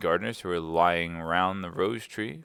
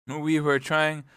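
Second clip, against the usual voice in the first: higher-pitched, humble excuse; 8 semitones higher, 4 dB louder, slower than usual. Acted. Gardener Two explaining to the queen, humble. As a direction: start explaining meekly in a higher voice. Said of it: "in a very humble tone".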